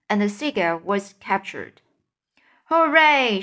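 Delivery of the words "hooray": loud high hooray; shouted cheer. A narrator's reading. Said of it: "shouted the old green grasshopper".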